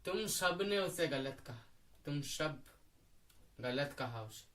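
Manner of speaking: low, soft accusation sinking away; hushed reproach. Acted. Ravana's defender blaming his listeners, in hushed reproach.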